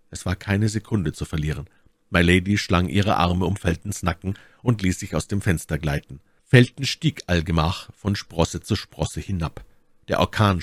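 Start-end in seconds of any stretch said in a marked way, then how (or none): none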